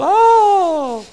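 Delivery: high, loud, long swooning "Oh!"; mock a fangirl's squeal, drawn out and high; swooning girls. Preacher mimics girls hearing [name] sing, high swooning cry. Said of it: "the girls would go".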